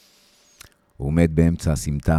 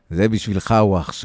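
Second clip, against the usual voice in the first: loud, higher challenge; defiant shout; 5 semitones higher, 7 dB louder than usual. Acted. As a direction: hurl it across the alley at him. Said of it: "אני צועק לו".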